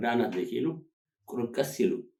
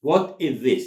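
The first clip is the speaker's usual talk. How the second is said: louder, words more separated; careful model repetition; 4 semitones higher, 8 dB louder, much slower than usual. Shown as a demonstration. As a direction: articulate it word by word. Teacher repeats it more separately.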